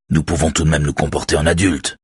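usual speaking voice

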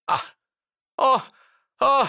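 short high oohs of agreement; assenting exclamations. A narrator's reading. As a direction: exclaim as the plan dawns on you. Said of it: "acquiesça le commissaire". (exclamations d'acquiescement)

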